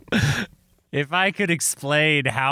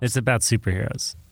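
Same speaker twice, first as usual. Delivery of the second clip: usual speaking voice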